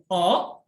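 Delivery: O as a rising Mandarin second tone. Shown as a demonstration. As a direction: lift O upward like a realizing "oh?". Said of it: "Nah, gitu".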